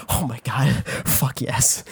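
low, soft retold relief; quiet relief. Storyteller seeing the hobo move, quietly relieved. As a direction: let relief out under your breath.

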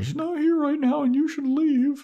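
very high falsetto telling him to leave; squeaky girl voice, mock firm; high falsetto Willow. Voicing Willow in recap.

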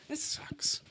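unvoiced whispered grumble. Real, not acted. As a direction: whisper it under your breath. Mutters under breath while losing.

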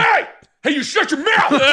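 high laughing yell, hammed-up anger; Will Ferrell style fake rage; mock furious shout. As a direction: bark it like a wannabe Will Ferrell. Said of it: "ready to yell at everyone".

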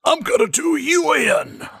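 high, hammy wrestler villain threat; Deitysaurus Flex. Acted. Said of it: "Deitysaurus Flex".

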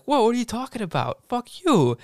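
high-pitched mock outrage; retold squabble, high and heated; indignant comeback. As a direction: act out an offended old coworker, voice jumping up. Retold fight, Willie firing back.

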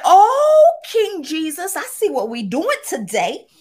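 high, loud, tearful rejoicing; joyful outcry. Retold or imitated. Thanking Jesus for a great sales day, high joyful cry. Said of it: "I said".

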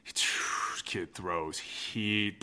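low confiding whisper; hushed whisper. Real, not acted. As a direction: whisper it like a secret. Bragging about a young pitcher.

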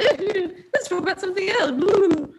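high, squirming mock-awkward deflection; English awkward. Brushing off a compliment, high mock-awkward voice. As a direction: dodge the compliment like a flustered Brit. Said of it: "I kinda go that English awkward".

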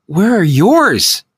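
high, slow retort; snapped back. Acted. Narrator snapping at the nurse, high and slow. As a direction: bite back at her. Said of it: "I snapped back at her".

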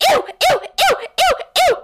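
very high squealing ews; mock screaming. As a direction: squeal in disgust. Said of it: "Sam is just screaming".

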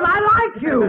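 the father shouting that he likes Mel; yelled fondness. Acted. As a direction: bark the I like you at him. Father yells his fondness.

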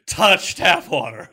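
loud posh mid-Atlantic impression of a friend; mid-Atlantic accent. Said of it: "I speak like Kelsey Grammer with a mid-Atlantic accent".

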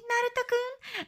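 put-on high, soft girlish "Naruto-kun"; Hinata's cute call. Hinata calling out to Naruto, high and sweet. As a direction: mimic a shy anime girl calling "Naruto-kun". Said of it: "imagine Hinata saying".

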